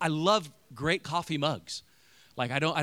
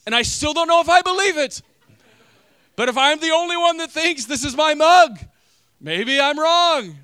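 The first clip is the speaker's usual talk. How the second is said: grudging concession; 13 semitones higher, 10 dB louder than usual. Retold or imitated. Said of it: "I was like".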